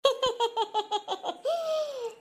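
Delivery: a sly, gloating villain's laugh; evil cackle. Acted. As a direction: cackle to yourself because the scheme worked. Daughter-in-law alone, plot succeeded. (षड्यंत्र सफल होने पर छोटी बहू की कुटिल हँसी)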